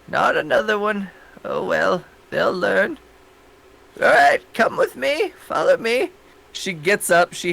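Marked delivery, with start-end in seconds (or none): 0.1-6.1 put-on old lady voice. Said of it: "She's like".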